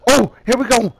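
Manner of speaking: rising cheer for his own hit; excited cheering. Real, not acted.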